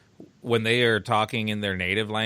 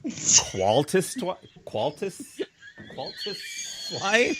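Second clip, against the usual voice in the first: laughing; 5 semitones higher, 5 dB quieter, slower than usual. Real, not acted. Struggles reading German.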